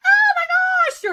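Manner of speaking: very high, loud squeal; shrieked excitement demo. Shown as a demonstration. Coach demonstrating feeling in the voice, high shrieked exclamation. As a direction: shriek it out, pitch right at the top. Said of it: "they could talk like this".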